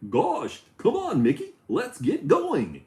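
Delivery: high, slow cartoon dog voice; Goofy impression. Streamer calls to Mickey as Goofy, high and slow. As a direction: drag it out like a dopey cartoon dog. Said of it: "All right, that's my impression".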